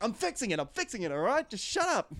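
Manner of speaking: high-pitched harried shout as the manager quieting tenants; Willem Dafoe snapping. Retold or imitated. Motel manager snapping at yelling tenants, high and exasperated. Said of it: "He's like".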